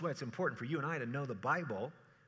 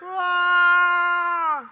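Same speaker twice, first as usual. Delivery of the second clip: sudden high shriek on the rapids; loud, high mock scream; panicked yell; 19 dB louder than usual. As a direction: scream like you're swept downstream.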